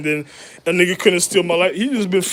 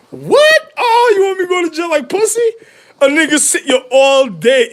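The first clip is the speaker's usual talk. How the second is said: much higher and louder indignant yell; outraged shout; 11 semitones higher, 8 dB louder than usual. Retold or imitated. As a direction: bark it back in mock outrage. Acted outraged reply to empress.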